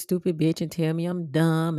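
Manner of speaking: ordinary talk, nothing marked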